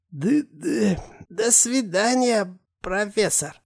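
high, slow stuttering simpleton; stammering hunchback. Acted.

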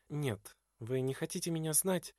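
soft, wounded question; hurt quiet question. A narrator's reading. Edwarda quietly asks Glahn, hurt by his coldness.

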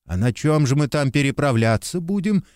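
plain reading voice

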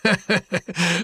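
sudden hearty guffaw, high; loud laugh. Acted. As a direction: burst out laughing loudly. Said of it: "Собеседник вдруг громко расхохотался".

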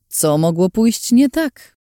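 mock-innocent irony; ironic. A narrator's reading. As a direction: drag it out with dry irony. Ironic rhetorical question.

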